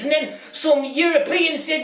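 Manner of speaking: usual speaking voice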